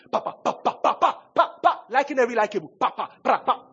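mock tapping sounds. Mocking tapping likes on posts.

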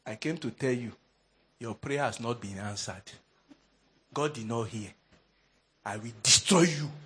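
low, soft menacing lecturer; wicked lecturer. Retold or imitated. Wicked lecturer to students who prayed against him, quiet menace. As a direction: deliver a quiet threat in a low voice. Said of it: "That's the way he talks".

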